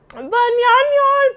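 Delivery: goofy, drawn-out hollered banyan yan; silly loud cry. Pretend. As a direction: belt it out like going cuckoo. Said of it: "am kinda going cray cray".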